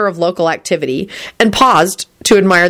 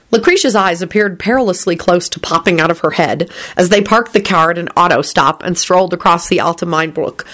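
plain reading voice

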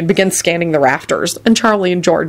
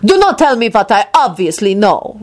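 higher, lordly snap; snapping; 5 semitones higher than usual. A narrator's reading. Lord Radu rebuking Barnabas, snapping. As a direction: indignantly cut him off. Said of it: "Radu snapped at him".

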